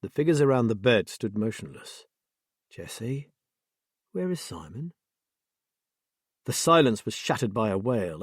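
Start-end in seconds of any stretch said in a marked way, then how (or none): 2.7-4.9 soft, careful, hushed asking; hushed question. A narrator's reading. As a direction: ask gently into the silence. Tense quiet question in cubicle.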